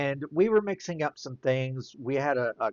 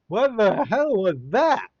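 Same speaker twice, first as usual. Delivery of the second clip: higher, louder startled yell; shocked cry; 8 semitones higher, 6 dB louder than usual. Retold or imitated. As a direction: blurt it out in pain and shock. Just sliced tongue with razor.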